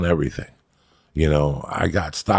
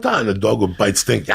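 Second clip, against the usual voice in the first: ordinary delivery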